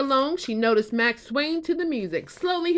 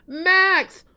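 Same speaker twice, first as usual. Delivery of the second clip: high, loud alarmed call; shouting; 7 semitones higher, 4 dB louder than usual. Acted. Flynn shouting to Max about stolen apples, loud. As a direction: shout the news to send him running. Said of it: "Flynn shouted".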